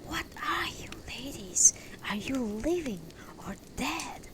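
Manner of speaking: breathless frightened whisper; afraid whisper. A narrator's reading. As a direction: ask it in a hushed fear. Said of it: "I hear myself ask".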